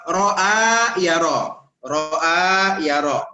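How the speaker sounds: drawn out syllable by syllable, ro'a ya ro; slow teaching demo. Shown as a demonstration. Teacher slowly models ro'a ya ro for the class. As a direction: spell out ro'a ya ro very slowly for students. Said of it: "misalnya begini".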